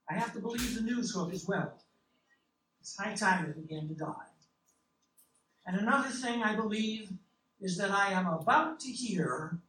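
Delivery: plain reading voice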